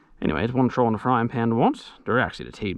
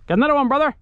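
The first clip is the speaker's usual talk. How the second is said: calling out; 13 semitones higher, 6 dB louder, much faster than usual. Real, not acted. Calling across to his brother.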